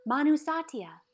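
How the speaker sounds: loud, high respectful call. Acted. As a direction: call her name out in honour. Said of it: "they would call out to her".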